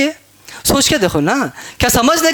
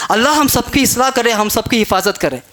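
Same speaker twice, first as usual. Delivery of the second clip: ordinary delivery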